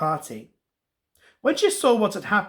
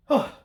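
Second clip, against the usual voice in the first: hushed, pretend-startled gasp; mock gasp. Storyteller snaps fingers for the child, gasping playfully. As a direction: gasp as if the magic worked.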